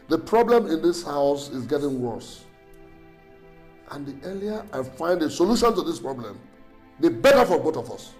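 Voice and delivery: loud, forceful warning; angry ultimatum. Acted. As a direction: lay down the law to your wife. Husband presses wife about childlessness.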